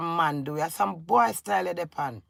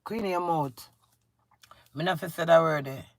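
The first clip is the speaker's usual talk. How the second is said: ordinary delivery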